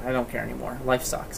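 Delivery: soft, defeated mumble of a sulking friend; imitated dejection. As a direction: give up, quietly acting crushed. Retells sulking friend giving up.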